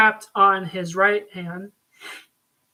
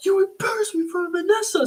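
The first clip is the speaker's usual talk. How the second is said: much higher, fast put-on snarl; furious Fisk; 11 semitones higher, faster than usual. Fisk raging at the Russian brother who embarrassed him. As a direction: spit it out like a humiliated crime boss. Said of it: "Fisk was like".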